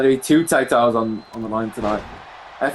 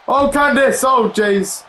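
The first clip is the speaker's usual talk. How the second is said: surprised outburst; 10 semitones higher, slower than usual. Real, not acted. Live reaction to a missed dive.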